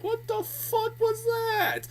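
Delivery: very high, drawn-out disbelief; incredulous outburst. Acted. Reviewer voicing a baffled viewer after the orgasm scene, incredulous. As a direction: squeal it slowly in mock shock. Acted stunned viewer reaction.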